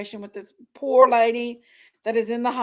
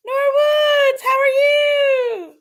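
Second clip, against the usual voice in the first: squealed greeting; 11 semitones higher, 5 dB louder, slower than usual. Real, not acted. Excited greeting to viewer.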